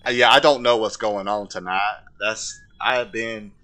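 put-on simple southern man's accent; Forrest Gump. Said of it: "I gotta talk like Forrest Gump".

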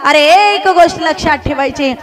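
high, ringing exhortation to the congregation; fervent preaching. Acted. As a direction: urge them on with stretched, emphatic key words. Exhorts congregation at full force.

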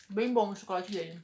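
chewing while talking; words muffled by food; mouth full. Real, not acted. Seen 2: pink-haired speaker's lips pressed, chewing-like motion in later frames.

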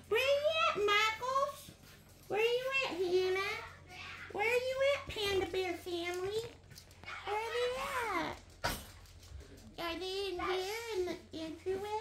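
high, soft searching call; playful seeking. Pretend. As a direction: pretend to hunt for hiders in a lilting hush. Seeker calls softly for hiders.

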